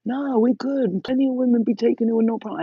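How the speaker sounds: high, loud, fast cocky guy; cocky boyfriend. Retold or imitated. Boyfriend insisting it will fit, high and brash. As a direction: brush her off, quick and sure of himself. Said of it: "He's like".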